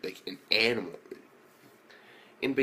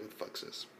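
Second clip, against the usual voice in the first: whispered shock; 14 dB quieter, faster than usual. Retold or imitated. Whispered freaked reaction.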